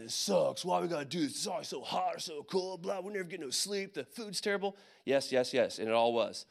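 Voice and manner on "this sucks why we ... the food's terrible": whiny run-on griping; complaining soldiers. Retold or imitated. Soldiers griping through training in Georgia, complaining. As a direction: imitate a bunch of troops moaning. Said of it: "everybody's complaining".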